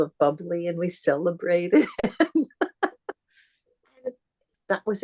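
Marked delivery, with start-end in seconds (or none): 1.9-3.1 high, happy laugh. Real, not acted. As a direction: burst out laughing at the memory. Laughs recalling the celebration.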